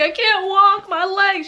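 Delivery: very high, wailing cry; mock screaming in pain. Telling her friend she can't walk, wailing. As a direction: wail as if in agony. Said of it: "I'm screaming because the pain is excruciating".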